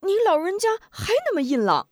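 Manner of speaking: high, quick, fond praise; 亲热地夸赞; affectionate cheer. Acted. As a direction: cheer up the old lady. Grandson flatters grandmother affectionately.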